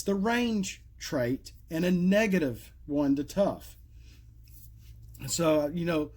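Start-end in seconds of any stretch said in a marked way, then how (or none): none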